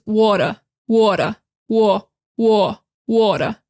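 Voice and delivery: New York "water", small mouth, no final r; New York accent. Shown as a demonstration. Teacher showing students the New York way to say "water". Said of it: "You see how, like, small my mouth is?".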